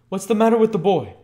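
loud, high exclamation of alarm; bustling cry. Acted. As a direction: burst out as a flustered doctor. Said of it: "cried the doctor, as usual, all in a bustle".

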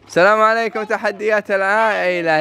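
ordinary talk, nothing marked

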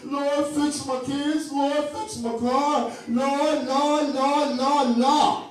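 mock the whiny same old prayer; begging prayer. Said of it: "Begging and begging".

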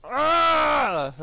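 frustrated yell. Real, not acted. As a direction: let out a long angry ah. Frustration after killing dino.

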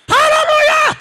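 high, loud Haleluya shout; shouted call. Real, not acted. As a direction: call it out to the crowd. Preacher calls out to congregation.

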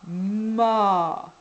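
"Ma" drawn out loud, long M swelling into A; insisted syllable sound. Shown as a demonstration. Reading back what was written, "Ma" slowly stretched. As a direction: stretch "Ma" so both sounds are heard. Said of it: "n'hésitez pas à insister".

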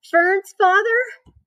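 high, drawn-out wail; sobbed question. A narrator's reading. Wilbur asking the old sheep about Fern's father, sobbing. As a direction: sob it slowly in high-pitched disbelief. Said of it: "sobbed Wilbur".

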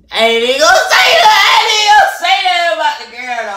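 high, fast shouting; loud self-restraint. Real, not acted. Holding back about the girl, shouting fast. Stopping himself from dissing the girl.

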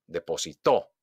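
"depositó" punched on the last TÓ; stress on final syllable. Shown as a demonstration. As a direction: land hard on TÓ in "depositó". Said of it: "hago un golpe de voz". ("depositó" con golpe de voz en TÓ)

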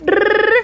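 playful tongue-roll call; trilled animal call. Pretend. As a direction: trill to call the horse over. Calling horse in play.

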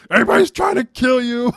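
high, loud hammed-up panic; exaggerated cry of danger; mock alarm. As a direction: imitate TV fearmongering at a yell. Mimicking alarmist TV news.

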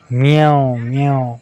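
slow, drawn-out mimicked meows; lightly voiced, lingering cat call; cat meow imitation. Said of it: "cat".

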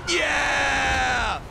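loud high cheer of triumph; victory shout. Real, not acted. Gamer cheering the team's win, loud yell.

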